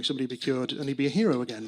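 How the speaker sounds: ordinary delivery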